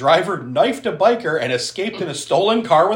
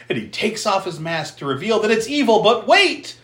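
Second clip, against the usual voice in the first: usual speaking voice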